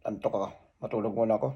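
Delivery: soft, fast, drowsy mumble to himself; sleepy mumble. Retold or imitated. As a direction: mumble it like you're nodding off. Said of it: "Sabi ko".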